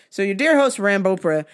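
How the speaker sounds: normal talking voice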